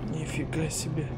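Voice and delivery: soft amazed murmur; quietly impressed; quiet amazement. Real, not acted. Same exclamation said twice.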